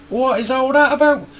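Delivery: high-pitched exasperated protest; exasperated disbelief. Real, not acted. Vlogger answering a complaint about their accent, exasperated disbelief. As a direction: ask it incredulously, pitch shooting up.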